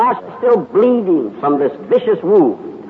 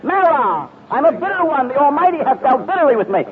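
bitter; 10 semitones higher than usual. Acted. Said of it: "she said to them bitterly".

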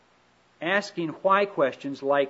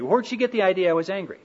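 ordinary talk

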